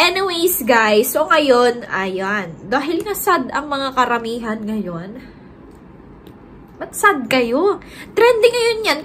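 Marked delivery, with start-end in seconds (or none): none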